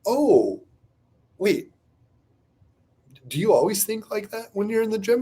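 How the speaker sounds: high, halting realization; surprised wife. Retold or imitated. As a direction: voice a wife taken aback, with pauses. Wife reacts to his admission.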